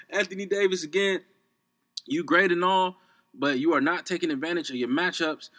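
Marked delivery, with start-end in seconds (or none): none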